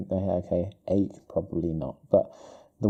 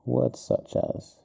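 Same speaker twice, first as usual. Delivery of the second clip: murmured dictation; 5 dB quieter, slower than usual. Real, not acted. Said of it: "I'm gonna put this".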